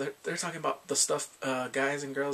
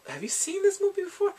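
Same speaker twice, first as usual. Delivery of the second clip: put-on high girly voice; 4 dB louder than usual. She asks him on the date.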